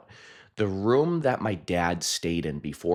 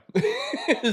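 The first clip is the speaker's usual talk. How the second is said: high squealing laugh. Real, not acted. High laugh after story.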